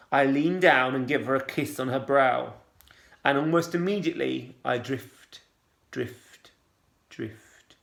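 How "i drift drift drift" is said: each drift softer until a whisper; fading away. A narrator's reading. Drifting off into a dream memory, fading to a whisper. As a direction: let the words dissolve into sleep.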